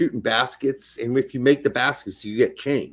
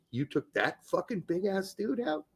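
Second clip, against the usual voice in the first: softer, high incredulous question; retold disbelief; 4 semitones higher, 10 dB quieter than usual. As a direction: imitate amazed schoolmates in a hushed higher pitch. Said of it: "they're like".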